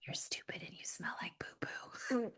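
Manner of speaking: put-on very soft, secretive toddler whisper; naughty whisper. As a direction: whisper it like a naughty little kid. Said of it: "they are whispering naughty things".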